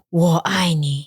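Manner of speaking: deep gruff Geralt-style 我爱你; Witcher voice. Pretend. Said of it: "你是Witcher吗".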